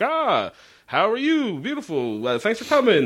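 mock jovial dad greeting; a hint of a cheesy host dad. Dad greeting guests at door.

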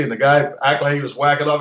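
usual speaking voice

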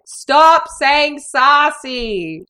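high, very drawn-out mock protest; exasperated plea. Viewer pleading with the instructor on screen, long high exasperated wail.

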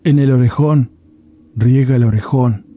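a dead spirit answering from a goat's head; voice from beyond the grave. A narrator's reading. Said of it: "una voz de ultratumba".

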